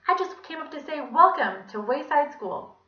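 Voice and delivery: high, loud lady's singsong; cheery Miss Mush. A narrator's reading. Visitor calling through the classroom door, cheery high voice.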